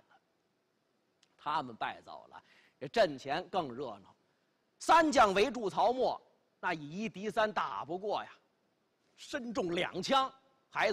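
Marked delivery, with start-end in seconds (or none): none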